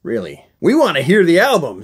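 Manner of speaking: ordinary delivery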